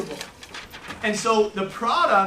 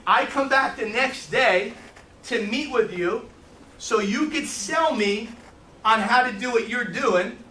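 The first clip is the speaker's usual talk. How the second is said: slow, deliberate; slower than usual. Real, not acted. Slowed explanation to trainees.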